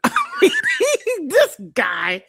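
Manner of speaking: high incredulous laugh. Real, not acted. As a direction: laugh in disbelief. Laugh heard.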